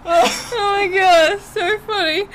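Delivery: slow, high, tearful laughing; crying with laughter. Real, not acted. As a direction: gasp it out in helpless hilarity. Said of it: "I'm actually crying".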